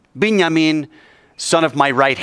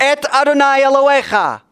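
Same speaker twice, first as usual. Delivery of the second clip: with gusto; 12 semitones higher, 4 dB louder than usual. Shown as a demonstration. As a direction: lead the congregation in a full-voiced prayer call. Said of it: "say it with some gusto".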